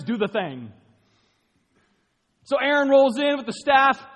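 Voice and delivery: loud storytelling preacher; energetic sermon retelling; preaching. Acted. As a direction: tell it big and loud.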